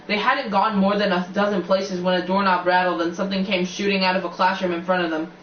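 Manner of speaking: usual speaking voice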